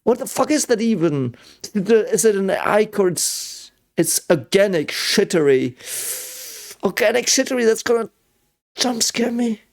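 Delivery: high-pitched, tense with dread; scared horror game streamer. Real, not acted. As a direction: voice climbing with fear.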